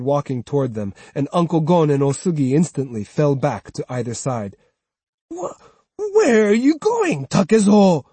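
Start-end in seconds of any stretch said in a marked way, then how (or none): 5.3-8.0 high, loud startled call; alarmed. Acted. Old woman startled as the swordsman walks off, high and loud. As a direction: call after him in alarm.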